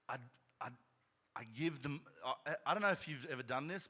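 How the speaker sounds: normal talking voice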